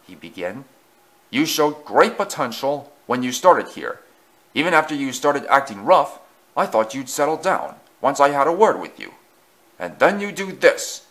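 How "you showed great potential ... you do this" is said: loud, emphatic telling-off; stern lecture. A narrator's reading. Fat Controller disappointed with Easton, loud stern lecture. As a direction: lecture with force, building to the accusation.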